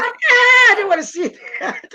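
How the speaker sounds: mock scared shriek. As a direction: squeal like the owl photo got you. Retold freak-out at owl pictures.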